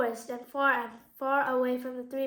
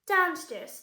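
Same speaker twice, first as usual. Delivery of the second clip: ordinary delivery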